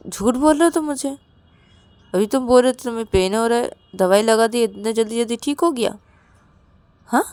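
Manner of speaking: loud, high, fast scolding; angry accusation. Acted. Rahul accuses Ravi of lying, loud and angry. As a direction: fire off accusing questions.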